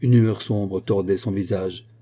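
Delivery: ordinary delivery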